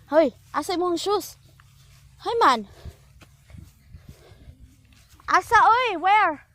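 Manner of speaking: calling out. Real, not acted. Scolding child for shoes.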